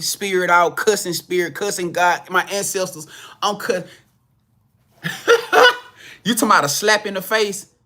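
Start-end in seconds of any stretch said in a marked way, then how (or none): 5.0-5.8 a quick squeaky burst of laughter; sudden high laugh. Real, not acted. Laughing at his own close call while telling the story. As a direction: laugh out high, caught off guard.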